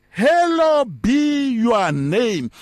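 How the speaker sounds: very slow, drawn-out reading; solemn scripture quote. Shown as a demonstration. Preacher quoting the prayer to the church, very slow and solemn. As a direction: intone "Hallowed be your name" slowly. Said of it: "it says".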